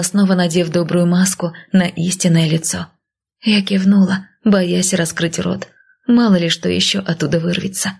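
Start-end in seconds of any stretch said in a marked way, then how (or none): none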